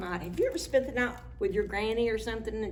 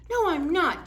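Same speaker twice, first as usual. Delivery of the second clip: grumpy retort; 8 semitones higher, 6 dB louder than usual. Acted. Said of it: "Jim scowled".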